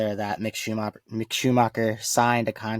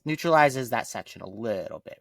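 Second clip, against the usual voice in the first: ordinary talk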